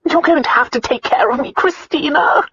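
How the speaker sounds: high, demanding whine; whining. A narrator's reading. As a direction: whine it up to the name. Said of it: "the aunt whined".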